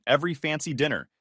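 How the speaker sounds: usual speaking voice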